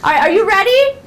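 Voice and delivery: high, loud call to the class; rallying teacher. Shown as a demonstration. Teacher starting the game round, high and rallying. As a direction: get the kids ready to play.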